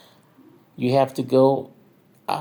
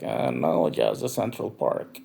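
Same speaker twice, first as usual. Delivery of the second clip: own reply in a deep voice; 10 semitones lower than usual. Retold or imitated. The narrator replays his answer to the dancer, lower voice. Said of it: "And I was like".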